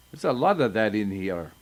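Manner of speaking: usual speaking voice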